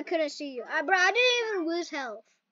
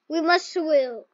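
usual speaking voice

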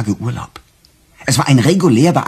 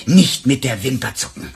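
ordinary talk, nothing marked

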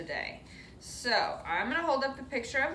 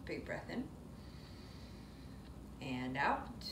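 soft, low, breathy counting of breaths; calm breath count; 6 semitones lower, 14 dB quieter, slower than usual. Acted. Yoga teacher guiding kids' breaths, soft and calm. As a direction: guide the kids' breathing gently and slowly.

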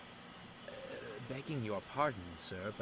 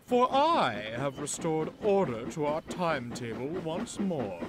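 lofty, loud boast to the station; pompous declaration; 6 semitones higher, 9 dB louder than usual. Acted. Pompous engine boasting to the station, grand and loud. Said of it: "he declared".

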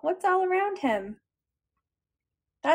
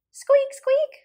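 high, louder squeaky mouse; mouse squeak; 11 semitones higher, 7 dB louder than usual. Acted. Storyteller voicing Mouse for children, high squeak. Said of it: "said Mouse".

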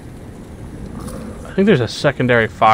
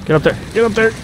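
urgent urging; 9 semitones higher, faster than usual. Real, not acted. Panicked urging in water.

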